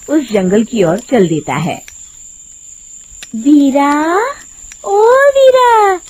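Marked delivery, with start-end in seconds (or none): 3.4-6.0 high, slow, drawn-out call; witch calling. Acted. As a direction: lure him in with a long call. Witch calls out to Veera.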